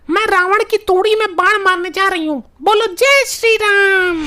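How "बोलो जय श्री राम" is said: slow, drawn-out slogan call; battle cry. Acted. Wife about to shoot at Ravan, drawn-out war cry. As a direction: stretch out the rallying cry.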